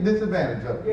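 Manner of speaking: the disadvantage slip, half-swallowed; slip of the tongue. Real, not acted. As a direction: trips on the word disadvantage, trailing off. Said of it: "not disadvantage".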